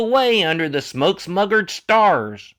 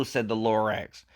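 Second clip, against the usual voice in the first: ordinary delivery, nothing marked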